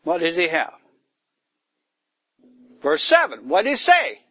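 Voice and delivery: ordinary delivery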